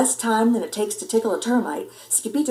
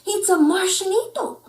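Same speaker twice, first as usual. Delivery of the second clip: excited; 10 semitones higher than usual. Acted. Pup seeing a Martian, high excited cry. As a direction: announce the discovery thrilled.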